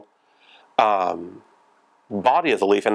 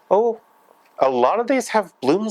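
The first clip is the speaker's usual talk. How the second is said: sudden high "oh" of surprise; surprised; 11 semitones higher than usual. Real, not acted. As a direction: caught off guard, pitch jumps. Surprised at bloom stalks.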